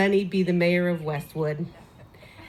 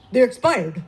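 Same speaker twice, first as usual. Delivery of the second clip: louder, laughing delivery; punchline; 3 semitones higher, 6 dB louder than usual. Retold or imitated. Lenny revealing the joke to neighbours. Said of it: "He goes".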